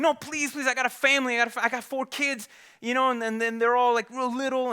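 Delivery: high, frantic begging; desperate pleading. Acted. Gambler begs the bookie not to hurt him, high and frantic. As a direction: plead for your life, voice up high.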